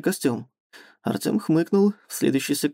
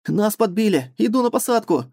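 shouting; 5 semitones higher than usual. Acted. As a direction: yell it over the engine. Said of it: "прокричал Васёк".

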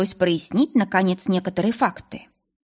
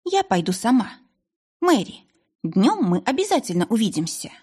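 firm decision, lightly lifted in pitch; resolute; 3 semitones higher than usual. A narrator's reading. Miss Hale overrules the maid and promises to visit, resolute. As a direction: state it with mild resolve. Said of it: "решительно возразила мисс Хейл".

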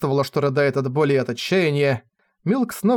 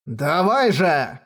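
slow, drawn-out roar; roaring command; much slower than usual. Acted. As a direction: bellow the order. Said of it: "взревел Калаут".

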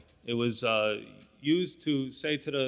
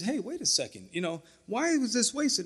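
higher-pitched, lightly mocking grumble; hint of a whiny Judas stirring up the others; complaining Judas; 9 semitones higher than usual. Judas stirring up the disciples, mock high whine. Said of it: "Judas infected the whole pack and said".

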